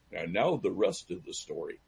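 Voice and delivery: lightly imitated storyteller catchphrase, low and soft; radio storyteller. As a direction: drop low and quiet like a teasing narrator. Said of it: "as they used to say".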